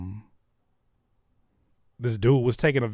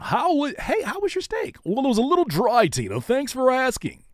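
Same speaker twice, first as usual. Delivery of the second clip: news anchor voice; 13 semitones higher, 4 dB louder than usual. Shown as a demonstration. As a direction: ham up a chirpy news anchor. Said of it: "If you can't be a news anchor and turn that shit off".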